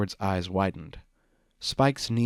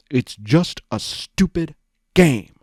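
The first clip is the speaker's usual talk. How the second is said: slow, loud, higher bitter snarl; slow bitter fury; 5 semitones higher, 8 dB louder, slower than usual. Acted. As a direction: drag each word out in fury. Big Mac swings sword in anger.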